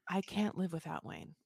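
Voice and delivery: softly voiced, imagined declaration of love; mock love confession. Imagining the wife confessing love for Wayne, softly. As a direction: imitate a hushed confession of devotion.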